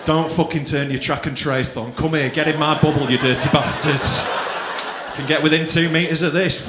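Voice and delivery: low, insistent illicit come-on; mock sexy talk. Lover inviting a partner into the bubble, low mock-sexy urging. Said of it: "that made it fucking sexy".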